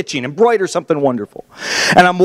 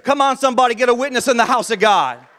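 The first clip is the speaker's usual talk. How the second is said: preacher's call; 7 semitones higher than usual. Acted. Preacher calling on the congregation.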